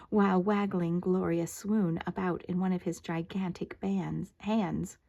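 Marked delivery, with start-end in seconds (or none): none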